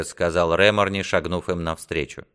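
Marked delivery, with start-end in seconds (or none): none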